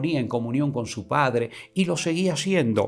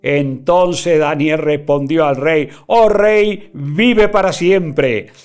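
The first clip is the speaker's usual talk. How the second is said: loud, declaiming Bible reading; raised preacher reading; preaching scripture; 4 semitones higher, 8 dB louder than usual. Acted. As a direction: proclaim the verses to the congregation. Preacher reads scripture aloud.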